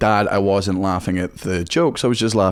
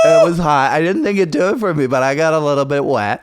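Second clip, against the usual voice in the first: higher, put-on American girl; female American accent; 5 semitones higher, 4 dB louder than usual. American woman confiding her crush, female American accent. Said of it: "I can only do female American accent".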